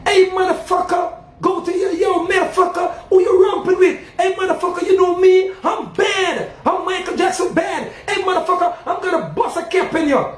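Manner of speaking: high, brash American gangster imitation; high, hammed-up thug voice mocking American slang; mock tough guy. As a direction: ham up a swaggering street thug. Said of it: "A man can say".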